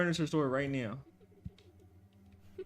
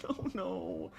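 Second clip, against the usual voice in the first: ordinary delivery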